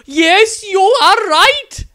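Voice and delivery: an adoring nun cooing at the wizard she loves; gushing besotted nun. Acted. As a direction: swoon over him like a lovesick nun. Nun answers eagerly.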